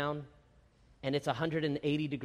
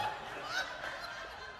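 high squeaky laugh; 12 dB quieter than usual. Real, not acted. Laughing at own joke.

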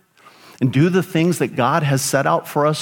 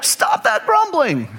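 much higher-pitched mock parental scolding; exasperated parent; 13 semitones higher than usual. Outnumbered parents to their five grumbling children, exasperated. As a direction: act out a parent at the end of their rope.

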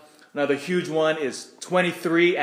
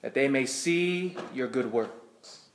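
scripture reading. Acted. Reads Gospel aloud at Mass.

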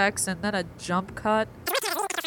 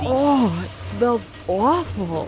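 disgusted by smell; 5 dB louder, slower than usual. Acted. Smeared with smelly gunk.

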